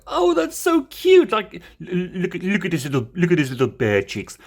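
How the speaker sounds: high-pitched gush over a cute toy; retold cute gushing. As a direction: squeal with delight, then come down.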